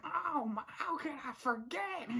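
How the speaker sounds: high, drawn-out cartoon accent; Hop Pop. Imitating Hop Pop taking the bait, high put-on accent. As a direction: wail it in a high mock character voice. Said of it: "doing the Hop Pop accent".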